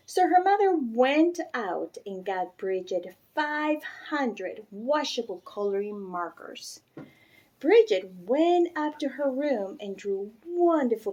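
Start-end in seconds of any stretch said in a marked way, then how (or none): none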